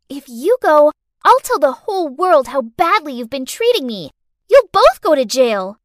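put-on loud child voice; scheming little girl, threatening. A narrator's reading. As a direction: threaten the grown-ups as a bratty child. Child figure in the story.

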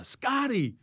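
high-pitched mock call to a teammate; retold shout for a teammate; imitated yell. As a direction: call the name out high, without real volume. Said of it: "he yells over to".

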